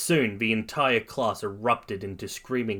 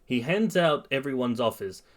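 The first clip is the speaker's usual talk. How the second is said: ordinary delivery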